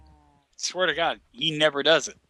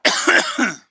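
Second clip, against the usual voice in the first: coughing; 13 semitones higher, 5 dB louder than usual. Real, not acted. Still choking on drink.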